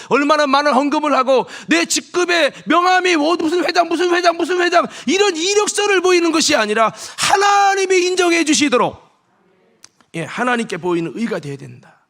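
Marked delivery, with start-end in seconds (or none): 0.1-9.0 higher and louder as it builds; rising preaching shout. Real, not acted. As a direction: preach it at full force. (점점 높아지며 외치는 설교)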